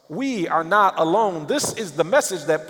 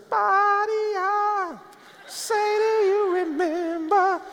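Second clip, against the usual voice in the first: high, slow ghostly mock voice with a pitch far above his own; mock spooky whisper; 14 semitones higher, much slower than usual. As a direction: ham up a cartoon ghost voice calling from beyond. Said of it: "No, he didn't hear that whisper".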